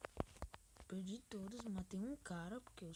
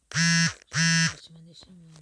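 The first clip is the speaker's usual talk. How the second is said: slow loud charging yell; battle cry; 3 semitones lower, 23 dB louder, slower than usual. Real, not acted. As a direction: roar it as you attack. Charging the opponent in game.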